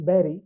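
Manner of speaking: বেরি with a clear r before the final vowel; R pronounced. Shown as a demonstration. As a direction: sound the r in বেরি. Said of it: "আর এর উচ্চারণ হচ্ছে". (র উচ্চারণ করে বেরি)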